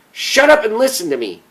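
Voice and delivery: high, loud forceful command; frustrated brother yelling down the phone. Acted. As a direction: bark the order so she finally listens. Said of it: "very loud and forcefully".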